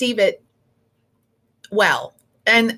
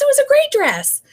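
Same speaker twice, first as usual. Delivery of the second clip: much higher, louder insistence; emphatic reassurance; 4 dB louder than usual. Retold or imitated. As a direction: insist the dress was great, half laughing. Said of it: "laughing a little bit and like".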